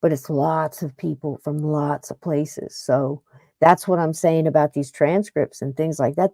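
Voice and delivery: normal talking voice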